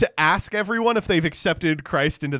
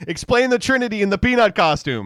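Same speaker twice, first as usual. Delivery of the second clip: loud, high heckle; hammed-up shouted command; mock yelled demand; 5 semitones higher, 7 dB louder than usual. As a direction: bellow the absurd order in mock outrage. Repeats the mocking demand, louder.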